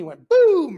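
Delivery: high, loud shouted boom; mimicking the shot at the wall; loud gunshot boom. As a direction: bark the boom like a pistol firing. Imitating second gunshot.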